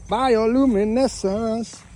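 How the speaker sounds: high, slow relish of "Bioluminescence"; drawn-out excitement. Real, not acted. Man spotting glowing water at night, excited and drawn out. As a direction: stretch out "Bioluminescence" high, syllable by syllable. Thrilled at glowing sea, repeats the word.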